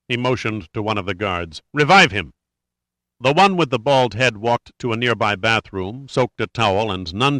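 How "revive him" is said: curt order, louder, with anger; infuriated order. A narrator's reading. Rondheim orders a guard to revive the victim, infuriated. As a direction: give the angry command, voice raised. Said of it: "Rondheim was infuriated".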